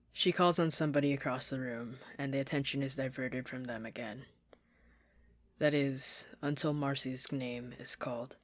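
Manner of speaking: ordinary delivery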